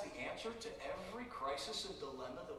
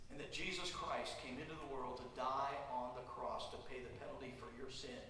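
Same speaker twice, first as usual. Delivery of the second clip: ordinary talk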